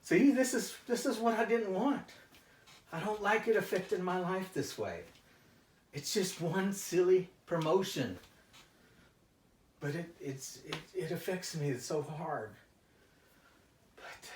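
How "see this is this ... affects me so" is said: agitated. Acted. Upset about losing control.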